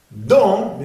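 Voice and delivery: emphatic nasal model of "Don"; strong nasal vowel. Shown as a demonstration. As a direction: push "Don" through the nose. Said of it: "Bastante sonido nasal".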